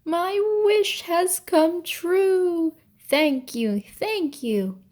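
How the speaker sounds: joyful shout. A narrator's reading. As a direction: shout it out in pure delight. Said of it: "then shouted after Bacchus".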